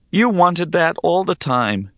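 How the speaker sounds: understated bitterness; bitter. A narrator's reading. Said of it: "I said bitterly".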